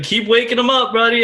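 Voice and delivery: high, loud ribbing; gloating tease. Retold or imitated. Co ribbing him after another big fish, high and loud. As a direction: crow it at your buddy.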